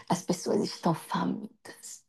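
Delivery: slow, soft whisper. Real, not acted. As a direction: lean in and whisper it. Sermon drops to intense hush. (sussurro lento e grave)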